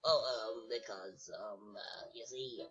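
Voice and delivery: low, soft, stammering bunny. Pretend. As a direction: stall quietly as a cornered bunny. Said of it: "who's actually been doing the, uh, bunny voice".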